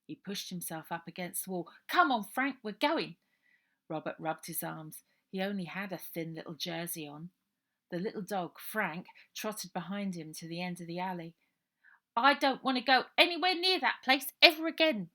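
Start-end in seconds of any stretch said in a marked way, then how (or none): 1.9-3.2 put-on louder cockney boy calling off his dog; brisk, call the dog and go; street boy Robert. Robert calling his little dog Frank away, loud cockney boy. 12.2-15.1 put-on loud, higher cockney boy, dead set; flat refusal from a frightened runaway; Robert declaring. Robert refusing to go near the orphanage, loud and firm. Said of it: "declared Robert".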